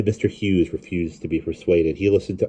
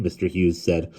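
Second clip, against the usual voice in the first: usual speaking voice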